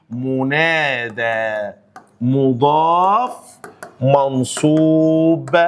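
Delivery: each word stretched very slowly; slow drilled parsing. Shown as a demonstration. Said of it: "teacher drills the parsing".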